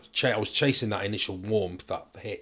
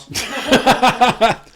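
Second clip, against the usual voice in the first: high, loud burst of laughter; big laugh; 11 dB louder than usual. Real, not acted. Laughing at own story.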